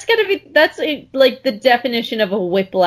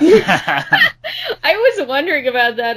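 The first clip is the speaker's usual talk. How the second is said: laughing; 7 semitones higher, slower than usual. Real, not acted. Laughing at the sexy reading.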